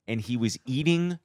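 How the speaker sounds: usual speaking voice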